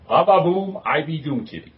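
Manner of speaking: ordinary delivery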